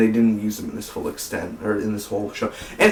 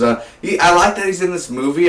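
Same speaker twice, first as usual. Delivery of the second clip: ordinary delivery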